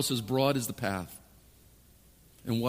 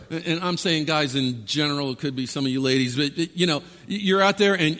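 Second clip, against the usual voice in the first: ordinary talk, nothing marked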